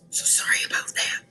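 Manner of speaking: hushed apology, a little embarrassed; whispered apology. Real, not acted. Librarian apologizes to kids for talking with a full mouth, whispered. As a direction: whisper a sheepish sorry.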